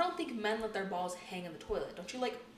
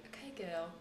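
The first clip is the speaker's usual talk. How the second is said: soft mock-British "Okay, girl"; imitated British accent; 10 dB quieter than usual. Host imitating the guest's way of talking, British accent. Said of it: "in your accent".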